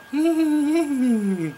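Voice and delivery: put-on high, loud, eerie childlike giggle; imitated ghostly giggle. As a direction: mimic a creepy little kid giggling. Imitating the giggling kids in closet.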